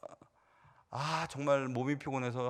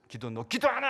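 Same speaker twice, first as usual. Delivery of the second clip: mock scolding yell; 11 semitones higher, 4 dB louder than usual. Evangelist hitting praying kids.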